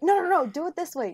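high, loud acted correction; mock bossy coaching. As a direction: jump in like a pushy onlooker. Acting out onlookers bossing a couple.

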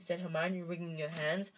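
plain reading voice

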